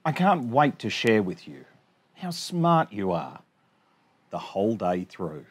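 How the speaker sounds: bouncy sing-song rhyme reading; reading to children. Acted. Teacher reading a rhyming picture book to her class, sing-song. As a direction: read the rhymes out brightly for little kids.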